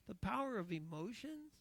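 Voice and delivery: ordinary delivery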